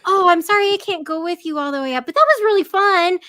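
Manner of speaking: put-on a giddy kid in the elevator; gleeful elf voice; cheery apology.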